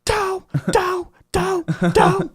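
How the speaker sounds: loud, very high repeated yelps; Homer Simpson impression. Host imitating Homer whenever a home run went off, high mock yell. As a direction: yelp it over and over like cartoon Homer. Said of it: "he yelled that every time".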